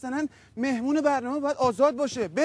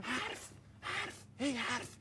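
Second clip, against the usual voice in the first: mock whisper; 16 dB quieter, slower than usual. Mocking talk as empty chatter.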